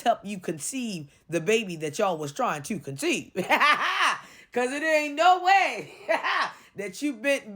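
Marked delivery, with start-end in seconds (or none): 3.4-4.2 bursts out laughing at the video; real amused cackle. Real, not acted. Laughing at the couple.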